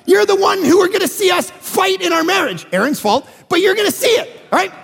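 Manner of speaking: rising, emphatic preaching voice; preaching at full force. Acted. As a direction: drive it home to the congregation.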